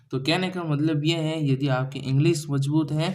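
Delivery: normal talking voice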